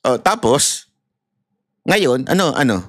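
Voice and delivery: ordinary talk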